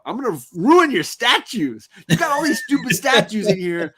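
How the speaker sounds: mocking taunt. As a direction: taunt him like a gleeful brat. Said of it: "and is like".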